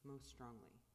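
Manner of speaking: calm, unhurried guiding of the breath; guided meditation. Acted. Leads a silent meditation.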